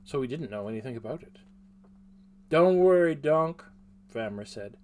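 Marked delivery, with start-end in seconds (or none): 2.5-3.6 loud cheerful call. Acted. Vamra reassures friend loudly.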